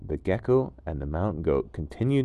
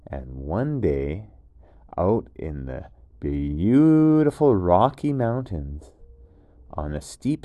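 bedtime story narration; 5 dB louder than usual. A narrator's reading.

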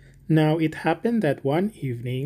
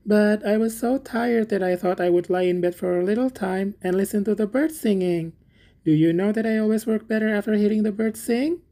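higher-pitched, meek little Hans; apologetic little Hans; 6 semitones higher than usual. A narrator's reading. Hans explains to the miller why he lay in bed, apologetic. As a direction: plead your excuse in a higher, humble voice.

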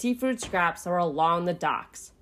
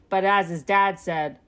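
plain reading voice

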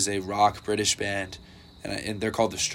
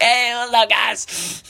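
high, bright-sounding greeting, much higher; mock cheery greeting; 12 semitones higher, 4 dB louder, slower than usual. Greeting a friend's dad on the train, high and loud. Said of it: "I was like".

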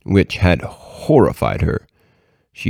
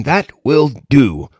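high, slow, emphatic exclamation; crying out in approval; 6 semitones higher, slower than usual. A narrator's reading. Satan sure of conquering the man, crying out. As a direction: draw it out in triumph, storyteller style. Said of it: "cried Satan".

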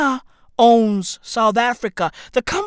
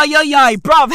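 ordinary delivery

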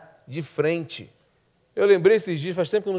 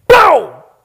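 sharp shouted pau, much higher and louder; mock smash; 11 semitones higher, 14 dB louder than usual. Guard smashing the vase, a shouted crash word. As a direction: bark out the crash of the stick.

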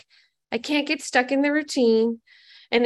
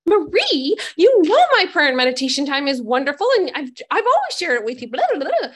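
higher, louder and fast, brushing her off; defensive protest; 3 semitones higher, 6 dB louder than usual. Retold or imitated. To her sponsor Marie, a defensive and fast protest. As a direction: insist it is all wonderful, a bit too eagerly.

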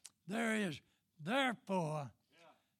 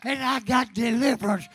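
preaching; 5 semitones higher, 8 dB louder than usual. Acted. Preacher testifying at full force.

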